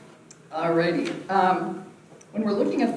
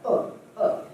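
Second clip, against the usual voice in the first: two short breathy grunts; quick belly bursts; punched-stomach grunts; 4 semitones higher than usual. Shown as a demonstration. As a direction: puff out "uh" twice from the gut. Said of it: "imagine that you're hit in the stomach".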